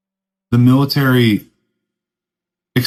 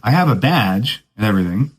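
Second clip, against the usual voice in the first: ordinary talk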